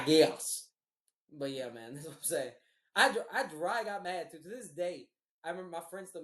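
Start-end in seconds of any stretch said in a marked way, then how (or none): 0.0-0.7 loud, drawn-out concession; mock giving in. Boyfriend giving in to his distracting girlfriend, loud mock concession. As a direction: blurt it out as a big grudging surrender.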